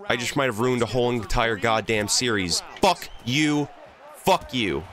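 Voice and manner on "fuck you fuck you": angry shout. Real, not acted. Gamer yelling at opponent.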